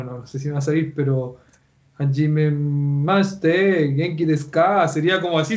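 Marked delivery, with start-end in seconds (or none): 2.0-4.9 drawn-out Chilean singsong in Japanese; Chilean-accented Japanese. Shown as a demonstration. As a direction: stretch the Japanese with a Chilean lilt. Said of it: "decir lo mismo con acento chileno".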